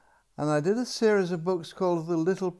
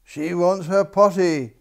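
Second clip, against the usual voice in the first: crying out; 2 semitones higher, 8 dB louder than usual. A narrator's reading. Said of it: "cried the king".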